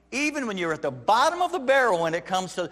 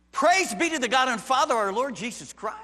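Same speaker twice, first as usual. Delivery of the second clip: Peter's praise; 8 semitones higher, 5 dB louder than usual. Retold or imitated. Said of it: "Peter begins by saying".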